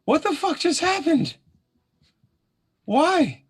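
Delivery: high-pitched, incredulous yelp; shocked outcry. Acted. Bystander reacting to the stiletto stabbing, shocked outcry. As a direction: demand answers in high, squealing disbelief.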